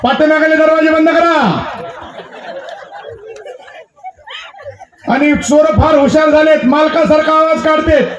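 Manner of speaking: high, sing-out public warning; town crier. Acted. Crier warns villagers about thieves mimicking husbands, proclaiming. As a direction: proclaim it on one high held note. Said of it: "तेवढ्या दुसरी दौंडी आली".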